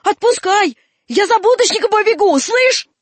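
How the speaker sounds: put-on high-pitched boy yelling; shouted threat. Васька threatens to fetch a policeman, shouted threat. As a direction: yell a warning, pitch climbing high. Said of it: "крикнул Васька".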